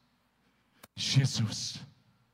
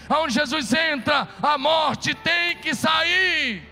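higher, louder, slow, hammering each "aonde"; preaching at full force; 7 semitones higher, 4 dB louder than usual. Acted. Preacher driving the congregation to faith, rising to a shout. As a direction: build it to a shouted climax. Preaching climax over music.

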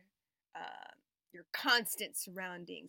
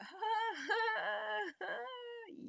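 high laughter; 12 semitones higher than usual. Real, not acted. Laughs adoring her chaotic kids.

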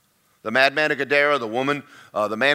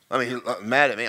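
usual speaking voice

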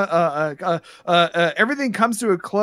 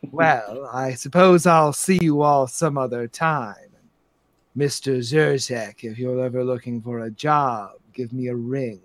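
composed madam; 6 semitones lower than usual. Acted. Madam says farewell and offers a job, coolly gracious. Game master plays Madam Storybrooke.

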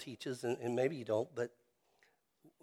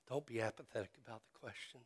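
fast and softer, like an aside; a rushed, softer echo of the plea; quick soft repeat; 12 dB quieter, much faster than usual. Real, not acted. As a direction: toss it off quickly and quietly. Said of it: "But I would beg you".

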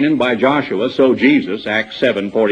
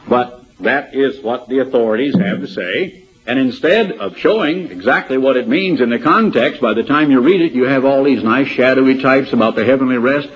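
ordinary talk

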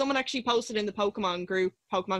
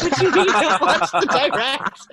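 higher, louder, laughing disbelief; alarmed disbelief; 6 semitones higher, 6 dB louder than usual. Retold or imitated. Replying to a friend's warning text, startled and louder.

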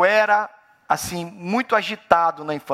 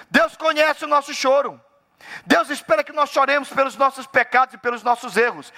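fervent preaching; 8 semitones higher than usual. Acted. Pastor warning believers, preaching hard. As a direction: lean into each word as a warning.

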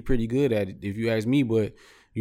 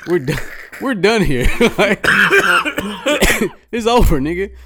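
louder and higher, laughing disbelief; loud incredulous rant; 6 semitones higher, 7 dB louder than usual. Real, not acted. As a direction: rant like it's the most absurd thing ever. Incredulous rant about the gift.

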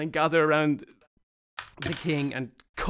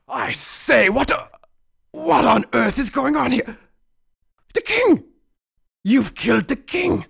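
loud, high, pompous outraged officer; outraged marshal; 5 semitones higher, 5 dB louder than usual. Acted. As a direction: bluster and bellow at the culprits.

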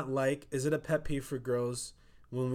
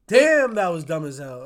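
much louder blurted remark; mock loud outburst; 4 semitones higher, 13 dB louder than usual. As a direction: blurt it out for everyone to hear. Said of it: "And I'll be like".

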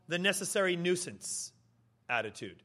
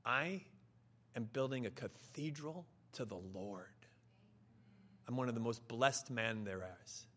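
hushed, low, reverent awe; humble worker quietly confiding a great privilege; quietly excited; 8 semitones lower, 11 dB quieter than usual. Acted. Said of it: "quietly but yet excitedly".

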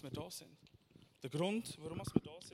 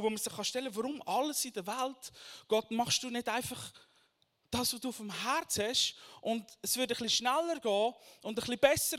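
louder preaching, raised pitch, urgent questions; 6 semitones higher, 6 dB louder than usual. Acted. Preacher asking God why, urgent preaching.

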